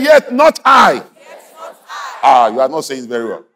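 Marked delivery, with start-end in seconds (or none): 0.0-1.0 very high, slow, loud declaration; rousing shout. Real, not acted. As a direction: stretch each word into a preacher's shout. Leads congregation in a declaration.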